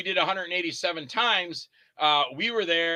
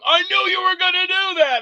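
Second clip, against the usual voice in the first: accusing friend; 13 semitones higher, 7 dB louder than usual. Retold or imitated. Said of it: "I go".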